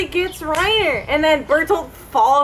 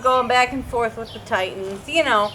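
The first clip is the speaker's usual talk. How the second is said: ordinary talk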